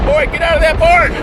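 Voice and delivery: mock stern old man's shout, pitched high; imitating great-grandfather yelling at a boy; a hammed-up bark at a kid in the barn; great-grandfather's scolding. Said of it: "Known for saying".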